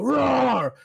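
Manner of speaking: loud playful monster roar. Retold or imitated. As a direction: roar it at the kid. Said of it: "Whenever I come out and I go".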